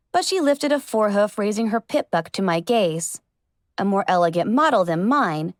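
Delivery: usual speaking voice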